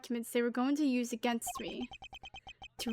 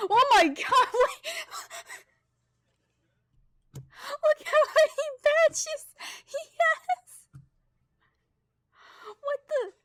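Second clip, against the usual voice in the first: much higher, laughing, then slowing in awe; laughing amazement; 13 semitones higher, slower than usual. Real, not acted. Streamer spots the old man's badges, laughing high amazement. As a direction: squeal with delighted disbelief.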